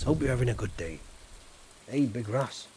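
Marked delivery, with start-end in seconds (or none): none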